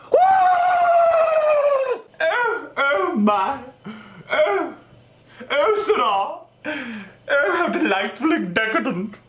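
high posh delighted voice. Retold or imitated.

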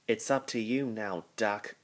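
plain reading voice

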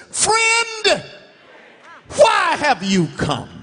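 high, long-held "Friend" that softens into the question; a hint of tender surprise toward a betrayer; Jesus greeting gently. Jesus speaks to Judas at the kiss, gently.

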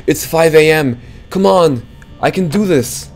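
high, loud urgent rallying; real urgency with the power almost gone; loud self-pep. Real, not acted. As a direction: push yourself on, nearly there. Power nearly gone, urging himself.

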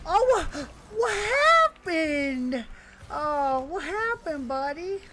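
high, drawn-out mock surprise; mock-concerned taunt. Real, not acted. Gamer to opponent buddy after a play, taunting.

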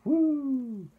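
high, loud ghostly wail; long hooting ghost noise; ghostly woo. A narrator's reading.

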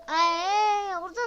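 high, loud ay; loud dismay. Real, not acted. Gamer yelping loudly at a mistake. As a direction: yelp at the mistake. Game setback.

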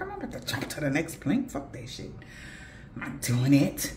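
soft, half-whispered, fast refusal; hushed refusal. Retold or imitated. On the broken bridge, refusing to jump, hushed and quick. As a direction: mutter it under your breath, no way.

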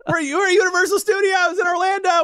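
high, shouting theme-park thrill; hammed-up excitement. Host pretending to visit Orlando with Jeff, hammed-up excitement. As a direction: play the overexcited tourist at full pitch.

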